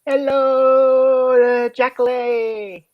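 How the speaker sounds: loud, high, drawn-out hello; sing-song greeting. Pretend. Calls greeting to niece in chat.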